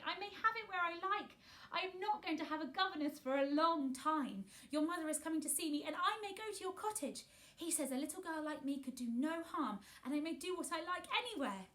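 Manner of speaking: high, eager rush of news; excited. A narrator's reading. Mary tells Martha her news, high and eager. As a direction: pour it out, thrilled.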